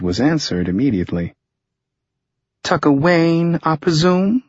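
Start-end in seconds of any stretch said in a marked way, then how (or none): 2.6-4.4 female voice. A narrator's reading. Harper answers the phone.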